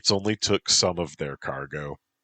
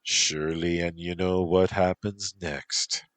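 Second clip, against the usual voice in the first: sultry Irish; 2 semitones higher than usual. Acted. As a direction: linger on it like an invitation. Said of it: "She licked her lips".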